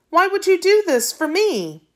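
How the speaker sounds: high, wondering question; amazed plea. Acted. Boy amazed the old man will teach him, high wondering. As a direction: ask it in disbelief. Boy moved by unexpected kindness.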